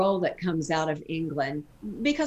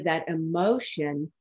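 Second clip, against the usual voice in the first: ordinary delivery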